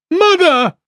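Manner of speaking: put-on a child's high, loud cry. A narrator's reading. Child breaks from the crowd calling its mother, crying out. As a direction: call out for mother in panic.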